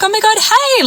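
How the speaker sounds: high squealed oh my God hey; excited girly greeting. Retold or imitated. Acquaintance spotting her at bowling, high excited hello.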